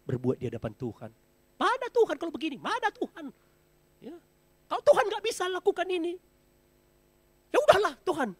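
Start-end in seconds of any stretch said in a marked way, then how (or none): 1.6-8.3 high whiny grumble at God, louder at the end; complaining believer. Acted. A faithless believer grumbling at God, high and whiny. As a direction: whine like a believer losing faith. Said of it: "Seringkali kita salah".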